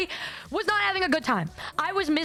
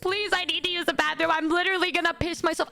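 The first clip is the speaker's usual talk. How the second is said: higher-pitched pleading retold; mock tearful begging; 4 semitones higher than usual. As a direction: act out a desperate plea for the bathroom.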